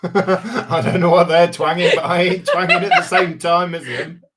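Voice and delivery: loud, high laughing protest. Real, not acted. Co-host joking about twanging along, laughing loudly. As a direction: talk right through the laughter.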